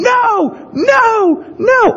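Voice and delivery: high-pitched imitated tantrum yell; screaming child. Dad mimics his child's all-day fit, screaming. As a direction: mimic a wailing child refusing everything. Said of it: "screaming and yelling".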